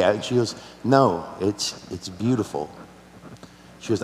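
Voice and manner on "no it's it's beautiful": soft, lower, halting reply; quiet, tearful child. Retold or imitated. Crying daughter reassures her dad, imitated quiet voice. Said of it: "was super quiet".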